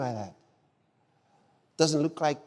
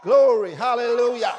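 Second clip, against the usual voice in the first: shouted praise; 2 semitones higher, 7 dB louder than usual. Real, not acted. Preacher praise.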